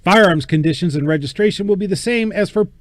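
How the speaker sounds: usual speaking voice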